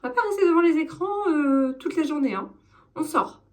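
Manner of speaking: hammed-up nagging mum, high shouting; daronne chiante. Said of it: "je suis la, la daronne chiante". (mère autoritaire qui crie, voix perchée, en jouant)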